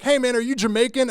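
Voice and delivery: high, loud eager question; excited stranger. Retold or imitated. Stranger running up in the mall, eager and loud. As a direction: run up and ask with big excitement. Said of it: "he was like".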